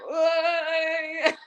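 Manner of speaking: high, stretched disbelief, then a laugh; shocked what. Retold or imitated. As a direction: caught completely off guard. Shocked reaction.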